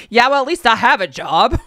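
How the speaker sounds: a cheeky comeback through a laugh. Pretend. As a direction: toss it off laughing, then walk away. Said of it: "Be like".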